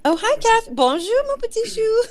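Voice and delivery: high, loud sing-song "Bonjour, mon petit chou" with a laugh; old French lady greeting. Retold or imitated. Greeting Kath in chat as the old French lady, laughing. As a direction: chirp it like a tiny French grandma, giggling. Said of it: "I'm such a dork".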